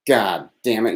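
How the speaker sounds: ordinary talk, nothing marked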